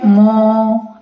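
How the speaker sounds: मो with its vowel held long and slow; long vowel demo. Shown as a demonstration. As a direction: stretch the o in मो to two beats. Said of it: "जिसमें दो मात्राएं हैं उसको कहते हैं दीर्घ". (मो के ओ को दो मात्रा तक खींचकर बोलना)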